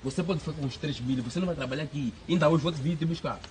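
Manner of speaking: quiet menacing warning to the woman; low quiet threat. Real, not acted. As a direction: drop it down and warn her.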